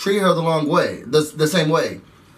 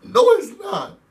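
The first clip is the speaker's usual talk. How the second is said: mock upset rant; 5 semitones higher than usual. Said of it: "And she's like".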